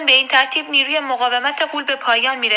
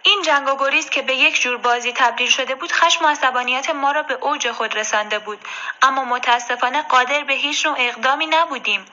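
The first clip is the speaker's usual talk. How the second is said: usual speaking voice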